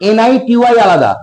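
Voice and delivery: normal talking voice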